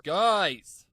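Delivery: sharp loud shout; angry snap. Acted. Golden snaps at his chatting friends, loud and sharp. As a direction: snap at them to shut up. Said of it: "Golden snapped at them".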